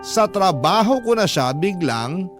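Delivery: ordinary delivery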